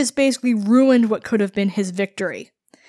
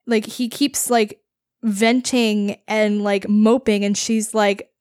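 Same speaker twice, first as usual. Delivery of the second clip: ordinary delivery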